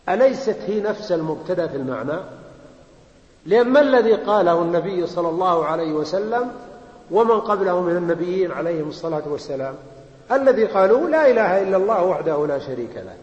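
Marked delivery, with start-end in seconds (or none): none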